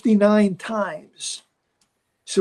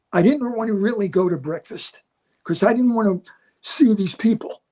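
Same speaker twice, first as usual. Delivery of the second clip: ordinary talk, nothing marked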